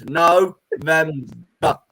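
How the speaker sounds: very slow, stretched insistence; drawn-out correction. Real, not acted. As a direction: drag the word out to correct him.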